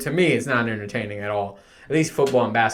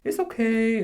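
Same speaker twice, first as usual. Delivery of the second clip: higher, coddling it's okay; 10 semitones higher than usual. Retold or imitated. Mocking a coach who is always too nice, soothing voice. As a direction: imitate a too-gentle coach. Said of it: "always be like".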